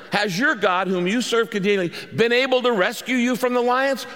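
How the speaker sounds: a king crying out in dread at the lions' pit; anguished calling out. Retold or imitated. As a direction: call out to him in anguish, afraid of the answer. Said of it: "in an anguished voice".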